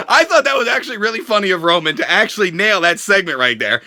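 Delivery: usual speaking voice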